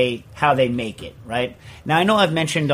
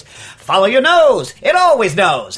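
loud, high, stretched-out mascot call; old cereal ad slogan; 5 semitones higher, 7 dB louder, slower than usual. Retold or imitated. Host recalls the old Froot Loops advertisement, hammy slogan voice. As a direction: swoop up big on the slogan. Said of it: "the old advertisement for Froot Loops was".

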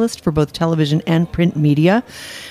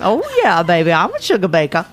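put-on Designing Women Southern accent. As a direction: play a sassy TV Southern belle accent. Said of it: "very Designing Women of you, that accent".

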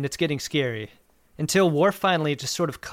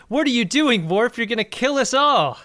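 higher, louder panicked-crew yell; yelling at someone; 8 semitones higher, 5 dB louder than usual. Retold or imitated. Crew member yelling at Worf on the bridge, retold yell. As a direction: yell it like he's about to doom everyone. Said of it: "People are just yelling at him".

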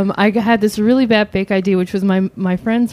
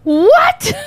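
high-pitched, incredulous squawk; mock a dumbfounded reaction, pitched way up; baffled. Liquor store clerk faced with a fake Brit, baffled.

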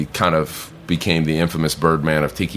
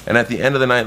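normal talking voice

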